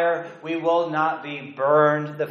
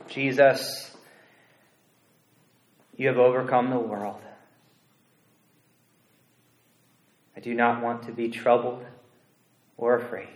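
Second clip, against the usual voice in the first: prayer; 3 semitones lower, 5 dB quieter than usual. Acted. Pastor leading the congregation in a soft prayer. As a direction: pray it quietly and humbly.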